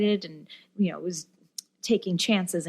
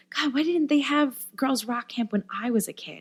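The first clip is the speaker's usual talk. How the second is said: higher-pitched, exclaiming imitation; wistful mother; 6 semitones higher than usual. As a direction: voice a mom wishing she'd had this. Voicing envious moms at the camp.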